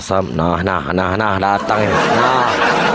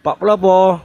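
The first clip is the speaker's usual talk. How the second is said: high calling voice, calling someone over; 9 semitones higher than usual. Real, not acted. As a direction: call his name across the room. Calling out to a stream guest.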